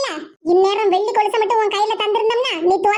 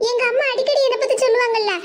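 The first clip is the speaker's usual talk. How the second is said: ordinary delivery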